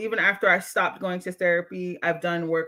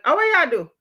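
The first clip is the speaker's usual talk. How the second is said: high, loud mock Jamaican relative; imitated Jamaican family outburst, pitched up; Jamaican family member; 8 semitones higher, 5 dB louder than usual. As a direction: exclaim it as an aghast Jamaican relative. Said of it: "my Jamaican family would be like".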